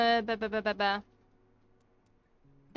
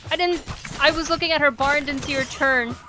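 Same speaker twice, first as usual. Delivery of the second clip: frustrated shout; 4 semitones higher, 7 dB louder than usual. Real, not acted. Failing a fight in the game.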